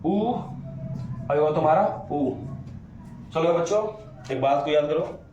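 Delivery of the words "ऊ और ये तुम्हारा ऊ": ऊ drawn out very slowly; long vowel demo. Shown as a demonstration. Teacher to students, stretching the long ऊ. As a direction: hold ऊ long and round. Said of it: "इसको बोलते हैं दीर्घ".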